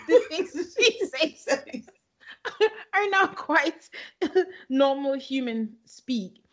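high-pitched, breaking up with laughter; laughing. Real, not acted. Host mocking a reality star's odd speech, laughing. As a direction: struggle to finish the sentence through giggles. Cracks up over bot-like remarks.

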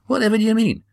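high incredulous what-do-you-mean; baffled question. Acted. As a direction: pitch shoots way up in disbelief. Damon baffled by strange order.

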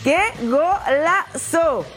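drawn-out thrilled "Qué golazo"; excited goal call. Real, not acted. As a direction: savor the goal, stretch it out. Goal exclaimed in highlights.

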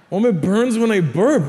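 put-on high kid voice complaining; imitated child's complaint. Six-year-old complains to mom, high mock distress. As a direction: play a distressed little boy. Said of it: "I go to my mom, I go".

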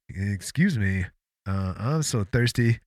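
a hint of a timid intruder; meek little voice. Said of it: "says in a meek little voice".

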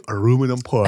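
mock Korean accent, lightly done; short joking burst of Korean accent. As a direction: put on a comic Korean accent. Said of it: "if I had to do a Korean accent".

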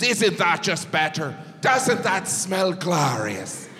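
put-on slow, savouring Irish accent; proud Irish friend. Friend proud of line-dried laundry, slow Irish accent. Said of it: "she was so proud".

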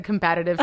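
"combative" as com-BAT-a-tive; habitual mispronunciation. Shown as a demonstration. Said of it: "I've always said combative".

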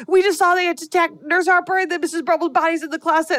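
high-pitched rushed report; frantic kids. Retold or imitated. Teens blurting out the attack to teachers, high and rushed. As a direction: blurt it all out in one breath like scared teenagers.

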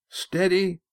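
high helm command called out; high, firm shout; shouted order. A narrator's reading. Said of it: "Estrella shouted".

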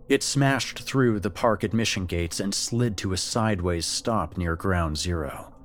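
ordinary delivery, nothing marked

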